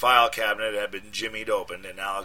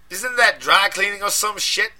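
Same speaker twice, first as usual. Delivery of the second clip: high, loud street voice in a panic; panicked friend; 12 semitones higher, 8 dB louder than usual. A narrator's reading. Deshaun panicking down the phone at his friend, loud and high. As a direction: yell it like you're scared and angry.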